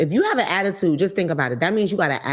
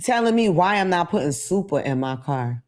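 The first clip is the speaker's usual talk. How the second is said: usual speaking voice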